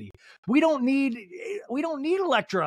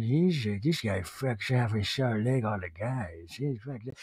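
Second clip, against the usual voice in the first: mock Mary; 7 semitones lower, 4 dB quieter than usual. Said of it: "Mary's going".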